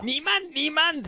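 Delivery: high-pitched pretend German; mock German accent. Host playfully trying Churchill in German, mock German accent. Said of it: "imagine that in a German accent".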